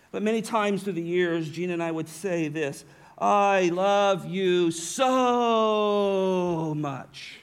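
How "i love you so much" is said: "so" drawn out long and loud; stress on so. Shown as a demonstration. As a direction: lean hard on "so" in "I love you so much". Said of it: "Put the emphasis on the "so".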